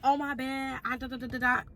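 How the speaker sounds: soft apologetic mumble; sheepish mumble. Retold or imitated. Voicing the sheepish boyfriend.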